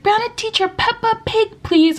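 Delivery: put-on very high pleading kid voice; put-on high child request; imitating young student. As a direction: mimic a small pupil begging the teacher. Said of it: "They'd always be like".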